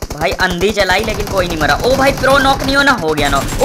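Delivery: ordinary talk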